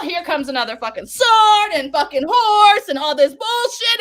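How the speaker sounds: usual speaking voice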